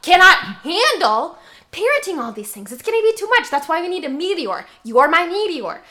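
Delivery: rising to a loud, excited outburst. Acted.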